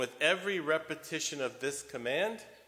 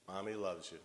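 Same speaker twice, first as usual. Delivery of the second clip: softer, gentle mother's words; mother comforting her son, softly; tender reassurance; 4 semitones lower, 8 dB quieter, faster than usual. Retold or imitated. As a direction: hush him with love. Mother hugging her screaming son.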